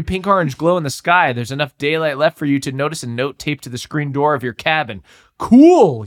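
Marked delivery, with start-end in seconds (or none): 5.4-6.0 high, loud eager exclamation; "Cool" shouted high and loud; loud cry. Acted. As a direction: call it out excitedly. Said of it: "you cry".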